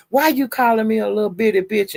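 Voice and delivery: a hint of a wounded boyfriend; quoted complaint. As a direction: act hurt and indignant. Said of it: "he was saying".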